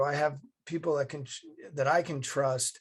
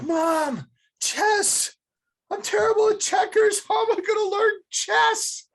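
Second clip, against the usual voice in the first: put-on much higher, louder whining child voice; whiny kid; 8 dB louder than usual. The boy complains to his mom about chess, in a high whine. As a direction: whine it like a hopeless twelve-year-old.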